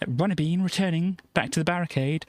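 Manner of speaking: ordinary talk, nothing marked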